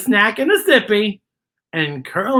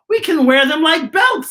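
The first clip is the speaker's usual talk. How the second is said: worm with idea; 9 semitones higher, 4 dB louder than usual. A narrator's reading. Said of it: "says Worm".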